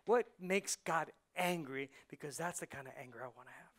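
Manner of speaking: usual speaking voice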